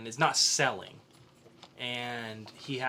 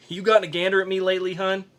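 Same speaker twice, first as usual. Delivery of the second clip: fast, louder scoff at the idea; incredulous; 5 semitones higher, 7 dB louder, faster than usual. Acted. Hero scoffing at the oracle, loud and fast. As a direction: laugh off the thought of seducing.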